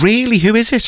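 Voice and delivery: high, intrigued mock question; retold question pitched right up; imitated eager curiosity. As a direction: act out an impressed seller prying. Imitates curious hot dog seller.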